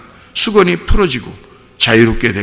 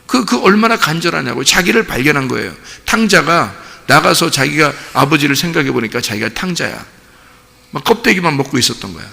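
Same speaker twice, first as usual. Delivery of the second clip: ordinary talk, nothing marked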